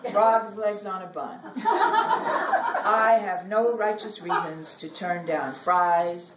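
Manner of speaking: measured, expressive poem reading with long pauses; poetry reading. Acted. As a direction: read it like a poet on stage, savoring each image. Reads her poem aloud.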